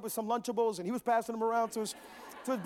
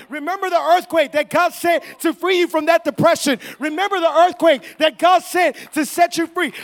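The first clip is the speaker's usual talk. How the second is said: loud, rallying preacher's refrain; full-force preaching; 5 semitones higher, 11 dB louder than usual. Real, not acted. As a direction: rouse the congregation with each repetition.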